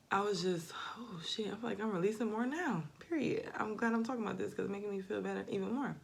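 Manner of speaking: normal talking voice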